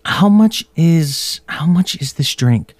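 high, slow, drawn-out sly asking; grandma haggling. Retold or imitated. Grandma slyly asking the vendor the drink price. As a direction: stretch it out sweetly, setting up the haggle. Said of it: "she's like".

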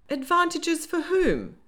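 high, slow, probing question; suspicious. A narrator's reading. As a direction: press for an answer with open suspicion. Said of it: "pressed April suspiciously".

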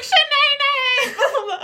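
high, excited calling out of the name, laughing; teacher calling out. Retold or imitated. English teacher spotting her former student, calling her name. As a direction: sing out the name like a delighted teacher. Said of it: "she's like".

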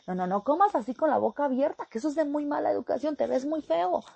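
put-on high, fast, loud scolding of a child; nagging parent. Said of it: "y le estamos diciendo".

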